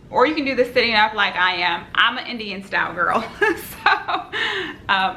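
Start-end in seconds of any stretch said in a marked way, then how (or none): 3.2-5.1 high, amused laughing filler. Real, not acted. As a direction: giggle through the words, pitched high. Laughs after calling herself Indian style.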